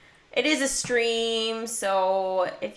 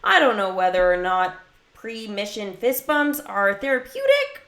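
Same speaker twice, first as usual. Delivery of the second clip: ordinary talk, nothing marked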